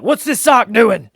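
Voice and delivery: hammed-up stressed dad fussing at a sock; exaggerated nitpicking. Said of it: "I'm exaggerating".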